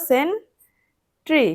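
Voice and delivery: সেন্ট্রি very slow, with a long gap before ট্রি; split into syllables. Shown as a demonstration. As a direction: draw out সেন, pause, then ট্রি. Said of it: "slash দিয়ে দাও".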